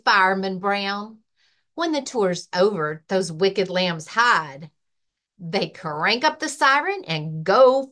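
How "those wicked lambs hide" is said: usual speaking voice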